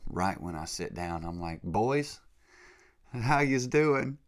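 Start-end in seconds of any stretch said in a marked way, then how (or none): 1.6-4.2 loud, high, drawn-out Jersey greeting; Jersey accent. Retold or imitated. Greeting Saint Peter's fans on sitting down, loud Jersey accent. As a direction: hail the boys in big Jersey style. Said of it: "I talk with a Jersey accent".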